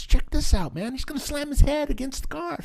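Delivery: high, keyed-up director impression; Quentin Tarantino. Director shows kid the dummy stunt, excited high voice. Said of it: "opens the door and goes".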